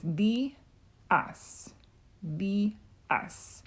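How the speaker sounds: "the" and "us" split by clear pauses; two syllables held apart, "the" long, "us" short; separated syllables. Shown as a demonstration. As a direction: sound out "the", pause, "us", then repeat. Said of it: "you see, is separated".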